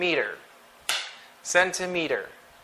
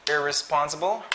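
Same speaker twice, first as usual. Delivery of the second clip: ordinary delivery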